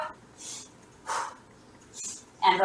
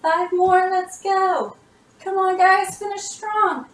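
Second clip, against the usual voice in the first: counting workout reps; 6 semitones higher, slower than usual. Real, not acted. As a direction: drive them through the last reps.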